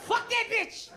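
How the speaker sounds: high, loud triumphant jab; triumphant yell. Acted. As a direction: shout it out with glee. Comic rant climax.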